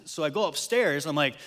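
ordinary talk

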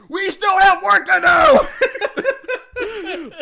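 loud, high-pitched mock yell; shouting. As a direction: shout it at him to get back to work. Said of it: "shouting at Michael".

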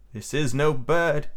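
slow, mildly scared voice. A narrator's reading. Slightly seeing the fallen Wendy, mildly scared. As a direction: draw it out with a touch of fear. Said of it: "he said in a scared voice".

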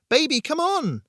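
very high, loud urging scream; desperate high-pitched yell. A narrator's reading. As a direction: scream for them to run to you. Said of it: "I screamed".

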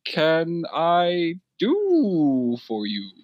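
high, slow-drawn turtle bartender greeting. Acted. GM as the tuxedoed turtle bartender greeting the player, high and slow. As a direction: voice a dapper turtle behind the bar, high. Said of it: "He goes".

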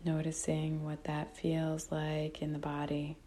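calm, unhurried meditation guide with long pauses; guided meditation. Real, not acted. Teacher guiding listeners to notice breath and body, calm meditation voice. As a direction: guide them gently, phrase by phrase, leaving long silences.

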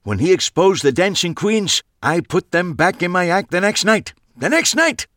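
high, loud, stressing the next night; proud old magician boasting loudly; emphatic. A narrator's reading. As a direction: hammer home the next night. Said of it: "he added for emphasis".

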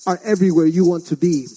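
rhythmic spoken word over maracas; performance poetry. Acted. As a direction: declaim it like a slam poet.

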